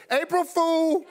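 high, singing-out gotcha; prank reveal. Retold or imitated. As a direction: crow it high as a teasing wife.

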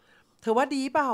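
high, loud, slow hopeful ask; eager question. Retold or imitated. Agent eagerly asking the customer after her pitch, high and slow. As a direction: fish for a yes, hopeful and drawn out.

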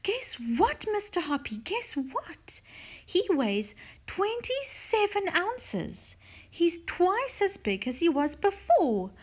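high-pitched, thrilled announcement; excited shouting. A narrator's reading. Missus Silver announcing Alfie's weight to Mister Hoppy, excited shout. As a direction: call out amazing news, pitched right up. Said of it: "and shouting".

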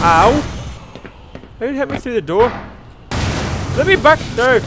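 loud, high angry shouting; raging gamer. Real, not acted. Gamer hit through door.

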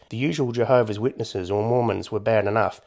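ordinary delivery

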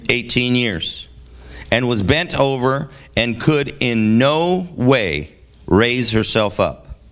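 ordinary delivery

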